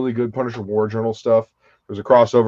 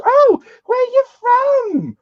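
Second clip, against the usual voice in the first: put-on very high, slow, delighted British-accent squeal; British tourist accent; 4 dB louder, slower than usual. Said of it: "an actual British couple would".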